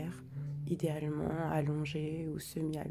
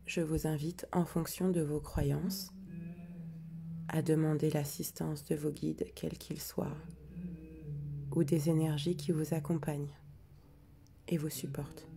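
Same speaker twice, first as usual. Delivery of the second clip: guided meditation. Acted. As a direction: ease listeners into calm, breath by breath. Guides the breathing and letting go.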